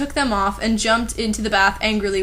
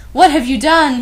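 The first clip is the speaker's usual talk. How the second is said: a half-playful demand, raised voice; mock outburst; 4 semitones higher, 7 dB louder than usual. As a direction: read a bit higher and louder, a hammed-up accusation. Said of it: "he started to cry wisely".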